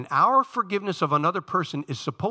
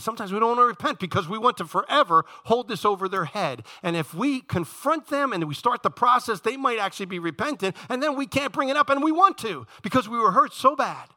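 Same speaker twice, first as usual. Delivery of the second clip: higher, urgent preacher's voice; impassioned preaching; 7 semitones higher, 4 dB louder than usual. Real, not acted. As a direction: drive the point home to the congregation. Preaches at full force.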